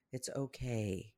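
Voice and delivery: soft, slow, low-key comfort; softly voiced reassurance; gentle reassurance. Shown as a demonstration. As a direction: soothe yourself quietly and slowly. Soothing herself, then repeats in whisper.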